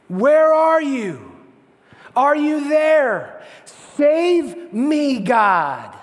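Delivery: loud, slow, aching plea to God; desperate prayer. Acted. Believer in crisis begs God to save them, loud and slow. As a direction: stretch each word out like a cry from the storm. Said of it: "God, my kids".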